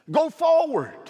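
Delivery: high, loud "Go forward" cried out; shouted command. Acted. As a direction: call it out at the climax. Repeated climactic command.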